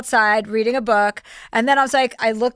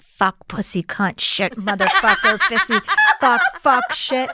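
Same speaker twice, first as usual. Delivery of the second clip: mock Tourette's outburst; slower than usual. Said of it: "auditioning Tourette's".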